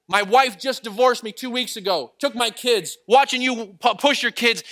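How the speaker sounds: loud, high, shouted confession; imitating a man hollering from afar; retold yelling. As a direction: shout your story across a park. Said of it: "He's yelling across the park".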